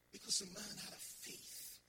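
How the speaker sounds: ordinary talk, nothing marked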